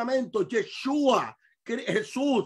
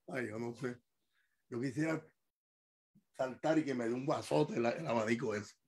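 low, very soft muttered aside; quiet aside; 11 semitones lower, 12 dB quieter, faster than usual. Real, not acted. Preacher to himself after the reveal, quiet aside. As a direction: drop right down, as if talking to yourself.

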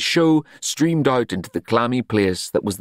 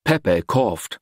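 usual speaking voice

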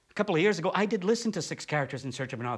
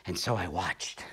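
ordinary delivery

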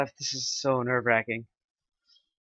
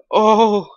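sharp gasp; 8 semitones higher, 13 dB louder than usual. Real, not acted. Sudden scare.

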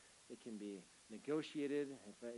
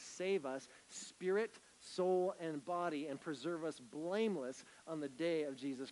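raised, high preaching voice, stretching key words; full-force preaching; 4 semitones higher, 6 dB louder than usual. Acted. Pastor preaching hard to the church about salvation, ringing and loud. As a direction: preach it out to the congregation with fire.

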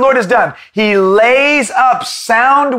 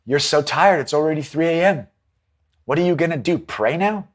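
lower, softer mocking whisper of the devil; devil's taunting voice; 7 semitones lower, 7 dB quieter than usual. Preacher voicing the devil taunting him at three A M, hushed. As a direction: taunt him quietly like the tempter. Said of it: "the deception that the devil".

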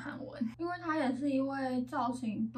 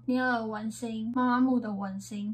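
normal talking voice

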